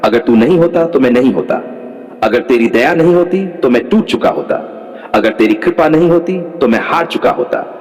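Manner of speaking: normal talking voice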